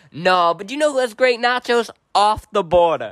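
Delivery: high, loud southern waitress voice, slowing at the end; southern twang. Retold or imitated. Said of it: "she got a southern twang and she goes".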